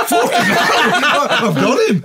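high, laughing triumphant gloat; gleeful laughing. Retold or imitated. Knocking the German down, high laughing gloat. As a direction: gloat through laughter like you've won. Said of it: "I'm like".

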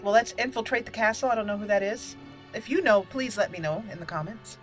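normal talking voice

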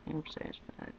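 whispered under the breath. Real, not acted. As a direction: breathe it out to yourself. Whispered repeat.

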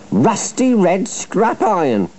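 high-pitched, offended complaint; hissed indignation. A narrator's reading. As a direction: repeat the insult in high indignation. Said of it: "hissed James".

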